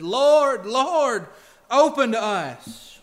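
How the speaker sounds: loud desperate plea at a shut door; pleading cry. Acted. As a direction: cry out, begging to be let in. Foolish virgins pleading at door.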